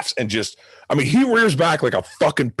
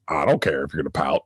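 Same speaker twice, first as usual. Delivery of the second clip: tough love; 10 semitones lower, 4 dB quieter, faster than usual. Retold or imitated. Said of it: "a little tough love".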